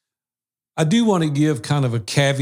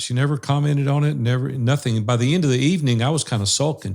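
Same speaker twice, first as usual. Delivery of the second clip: ordinary talk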